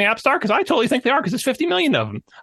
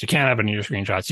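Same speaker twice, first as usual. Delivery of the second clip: lower, more deliberate reviewer repeat of "can't"; stern reviewer; 11 semitones lower than usual. Acted. App reviewer laying down the rule to a developer, lower. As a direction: drop the pitch and lay down the rule.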